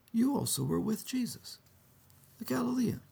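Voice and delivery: put-on high voice of a young servant girl. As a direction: point Peter out in a high voice. Servant girl accuses Peter.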